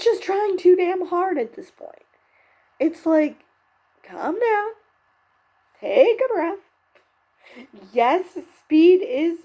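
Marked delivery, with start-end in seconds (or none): 4.0-9.4 higher-pitched mock coaching; exasperated imagined plea; exasperated advice. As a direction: pitch it up as if reining someone in. Imagined advice to over-eager contestant.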